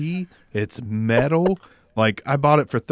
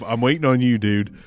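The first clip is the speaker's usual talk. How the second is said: ordinary talk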